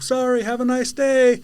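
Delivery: high, loud call across the street; retold high-pitched polite shout; cheery call back. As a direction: holler it sweetly at an angry driver. Calling back to the yelling driver.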